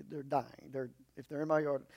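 ordinary talk

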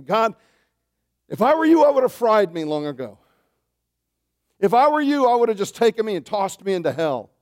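high, loud pleading cry; loud, desperate mock prayer; anguished confession to God. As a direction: beg God as a guilty sinner. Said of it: "I pray and I say".

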